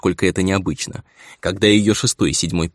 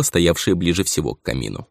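ordinary delivery

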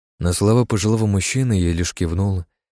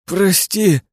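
pleading; 11 semitones higher, 4 dB louder than usual. A narrator's reading. Boy begs forgiveness.

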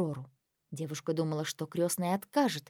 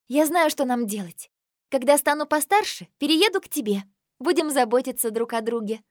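higher, louder and eager; bright with a new idea; excited girl; 5 semitones higher, 5 dB louder than usual. Acted. Aurora's eager plan.

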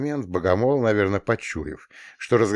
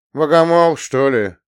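put-on sullen boy; 6 semitones higher, 8 dB louder than usual. Mishin answers counselor.

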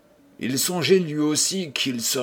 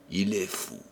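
bitter murmur; 3 semitones lower, 7 dB quieter than usual. Acted. Old man quietly mocking the guests who think him mad. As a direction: mutter it low and bitter.

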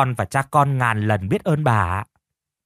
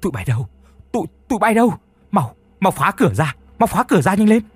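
much higher, louder panicked yells for the servants; frantic shouting; 8 semitones higher, 4 dB louder, faster than usual. A narrator's reading. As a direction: scream orders in a panic. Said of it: "Bà Tào đã chu chéo cả lên".

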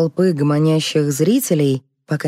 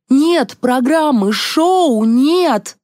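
high, drawn-out wail of alarm; despairing cry; 10 semitones higher, 4 dB louder, much slower than usual. A narrator's reading. Colleague announcing the live show is dead, despairing.

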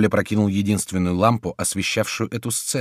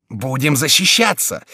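raised, resolute rallying cry; bold, resolute; 6 semitones higher, 5 dB louder than usual. A narrator's reading. Mayenne rallying everyone to defend themselves, bold cry. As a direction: cry it boldly and decisively. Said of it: "смело и решительно вскричал Майен".

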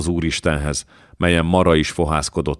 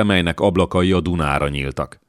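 ordinary delivery, nothing marked

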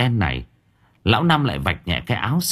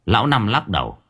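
usual speaking voice

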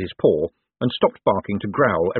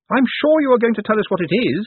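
high, raised reporter's voice; shouting; 13 semitones higher, 4 dB louder than usual. A narrator's reading. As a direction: call the challenge out high. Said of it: "shouted Saccharissa".

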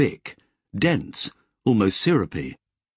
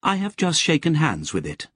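higher, crisp machine voice from a speaker; Lobsang the AI; 8 semitones higher than usual. Acted. Lobsang reporting to Joshua from the backpack speaker, crisp and higher. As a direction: chatter it crisply like an AI over a speaker. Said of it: "Lobsang said".

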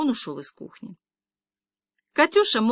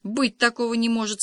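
plain reading voice

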